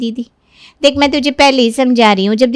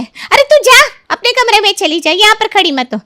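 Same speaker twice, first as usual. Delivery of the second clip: shooing shout; 9 semitones higher than usual. Acted. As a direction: send the girl out of the room now. Mother shoos daughter away.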